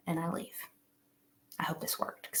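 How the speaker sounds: soft breathy whisper to herself; whispered aside. Real, not acted. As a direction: let it slip out under her breath. Whispered aside while leaving.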